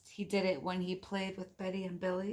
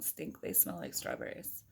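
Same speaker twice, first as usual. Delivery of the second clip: joking whisper; 6 dB quieter than usual. Pretend. Joking aside to child.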